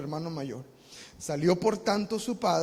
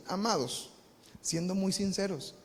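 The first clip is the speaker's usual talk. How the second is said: usual speaking voice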